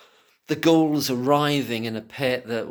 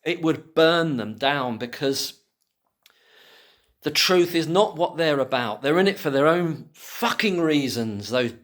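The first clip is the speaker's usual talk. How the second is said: ordinary delivery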